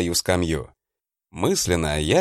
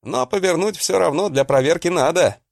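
shouting; 8 semitones higher than usual. Acted. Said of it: "крикнул я".

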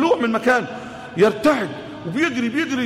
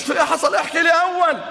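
demanding wife; 7 semitones higher than usual. Acted. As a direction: fire questions at him like a nagging wife.

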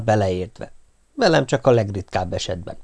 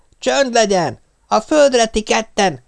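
high, slow shout of command; yelled order; 11 semitones higher than usual. Acted. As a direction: yell at the two of them to get down. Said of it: "ordított felénk hadonászva".

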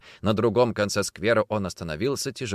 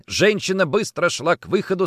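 usual speaking voice

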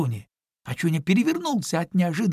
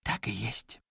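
ordinary delivery

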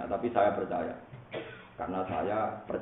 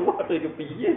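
mock bewilderment; 7 semitones higher than usual. Confused angels wondering what to do, in mock dismay. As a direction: blurt it as if at a loss. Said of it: "Wong akhir bingung".

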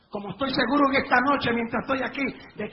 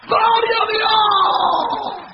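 slow, very high, full-throated cry; ecstatic shout of praise; 8 semitones higher, 10 dB louder, much slower than usual. Acted. Risen man leaps up praising God, ecstatic shout. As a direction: cry out like a man just raised from the dead.

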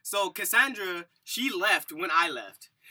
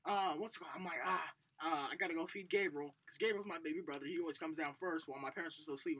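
morning self-talk; 11 dB quieter than usual. Retold or imitated. Talking himself through the morning chores, quiet and casual. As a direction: think aloud quietly in an early-morning voice.

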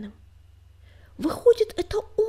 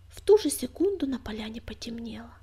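ordinary delivery, nothing marked

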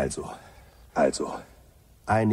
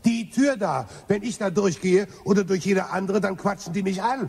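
much higher, aggrieved complaint; indignant whine; 10 semitones higher than usual. Acted. Zafford complaining indignantly that the doors talk to him. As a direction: protest like you're the wronged one.

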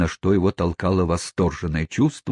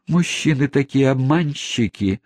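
higher, coy spinster voice; coy lady voice; 7 semitones higher than usual. Acted. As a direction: sigh it coyly. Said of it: "чуть слышно прошептала".

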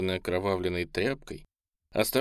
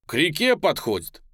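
higher, eager announcement; thrilled, pitched up above narration; excited; 6 semitones higher, 4 dB louder than usual. A narrator's reading. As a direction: call it out with delight. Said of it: "восторженно говорит Катов".

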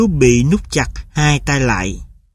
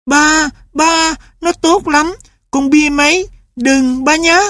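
put-on much higher child's plea; pleading boy; 12 semitones higher than usual. A narrator's reading. Boy begs father for the dog, much higher. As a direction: beg father to let the dog stay.

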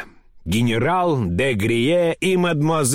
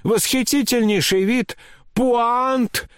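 high-pitched anxious coaxing; trembling coaxing; 11 semitones higher, slower than usual. A narrator's reading. General inviting his aunt on an outing, high and desperately coaxing. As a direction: tempt her desperately, voice pitched way up. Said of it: "Голос у него дрожал и падал".

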